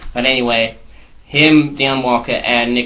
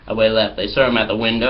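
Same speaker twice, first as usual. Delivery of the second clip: ordinary delivery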